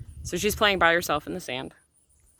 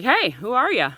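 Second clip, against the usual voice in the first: sharp, louder, higher demand to a stranger; stern angry challenge; 7 semitones higher, 5 dB louder than usual. Retold or imitated. Mother confronting a stranger beside her daughter, sternly angry. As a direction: confront her hard, pissed off. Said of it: "I walked up very sternly because I'm pissed".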